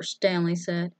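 ordinary delivery, nothing marked

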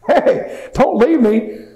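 loud, high-pitched shout after people leaving; re-enacted plea, hollered; calling out. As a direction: yell to stop them walking off. Calls to soldiers walking past.

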